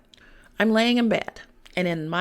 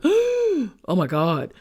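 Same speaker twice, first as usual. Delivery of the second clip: startled gasp; 6 semitones higher, 5 dB louder, much slower than usual. Retold or imitated.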